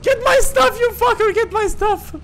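high yelling outrage; angry shout. Real, not acted. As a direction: scream at the thief. Teammate grabbing his loot.

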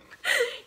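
a sudden high squealing laugh; high squeal of laughter. Real, not acted. Laughing at her own story, high squealed laugh. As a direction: let out a shrill little laugh.

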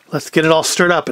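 normal talking voice

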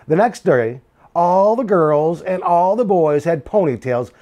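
lively picture-book read-aloud; reading to children. A narrator's reading. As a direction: tell it to a class of kids.

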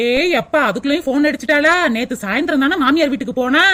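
mocking man. Husband scoffing at the mother's fuss, low sarcastic.

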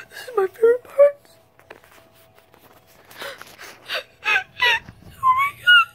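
high, loud mock wailing; fake sobbing. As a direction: wail it tearfully, hammed-up. Hammed-up sobbing over a sad movie.

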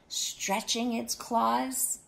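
plain reading voice

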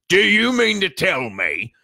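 slow, loud, high-pitched outrage; angry growl. A narrator's reading. Giant confronts the cowering family, growling angrily. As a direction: draw it out, seething and loud. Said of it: "he growled at the Dursleys".

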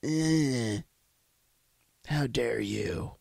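slow, whispered mock of the activist; hammed-up breathy imitation of Greta. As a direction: sneer at her by imitating her. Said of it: "she's like".

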